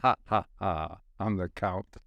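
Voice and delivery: deep, far-off vampire count voice; lightly voiced Count laugh; mock count. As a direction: ham up a spooky count.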